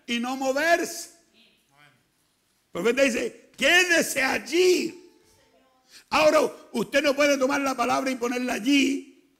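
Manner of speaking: loud, high preacher's cry, pressing each phrase; full-force preaching. Acted. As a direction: preach it hard to the congregation. (predicación a todo pulmón)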